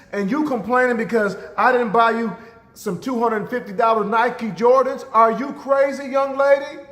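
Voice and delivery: loud, high-pitched angry scolding; mom losing it. Retold or imitated. Mother whipping her complaining daughter, acted furious tirade. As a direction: play an outraged mother telling off her child. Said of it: "Mom lost it".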